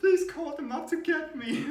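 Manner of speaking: high, loud begging through laughter; high pleading whine. Retold or imitated. Stuck kid begging the teacher for the scooters. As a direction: plead like a stranded child. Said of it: "I even said to the teacher".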